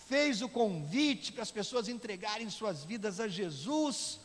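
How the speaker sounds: loud, high, forceful pulpit delivery; preaching at full force. Acted. Preacher telling the congregation of revival night, full-force preaching. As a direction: project the story to the whole church.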